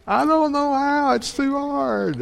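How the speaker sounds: mock child whining it's too hard; whiny child. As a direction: whine like a kid who won't try. Child voiced about math.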